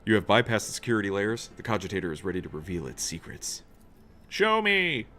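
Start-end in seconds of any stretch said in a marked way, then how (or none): 4.3-5.1 high demanding cry; commanding shout. Acted. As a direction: command the machine loudly. In-character command to machine.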